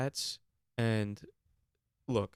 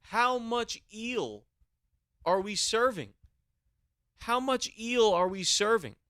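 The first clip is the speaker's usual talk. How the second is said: incredulous outcry; 11 semitones higher, slower than usual. Real, not acted. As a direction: stretch it out, outraged. Incredulous rant about eel.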